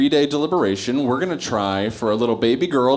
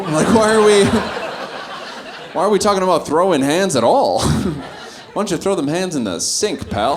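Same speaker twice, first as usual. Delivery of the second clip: higher, laughing mock retort; laughing comeback; 7 semitones higher than usual. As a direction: laugh through the comeback at the bully.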